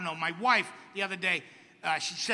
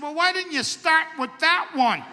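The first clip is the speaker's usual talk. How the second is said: exasperated outburst; 6 semitones higher, 5 dB louder than usual. Retold or imitated. Reacting to erection news.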